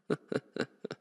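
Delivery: short voiceless chuckle; low-key chuckle. Acted. Vampire amused by her kneeling captive, quiet chuckle.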